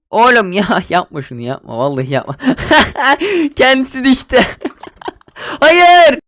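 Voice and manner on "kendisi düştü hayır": high giggling, ending in a loud high no; cackling at the fall then loud cry; laughing shriek. Real, not acted.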